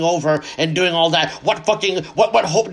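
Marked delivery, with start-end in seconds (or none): none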